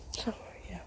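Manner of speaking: breathy whisper under the breath; a whispered aside while winded. Real, not acted. Breathless hiker muttering.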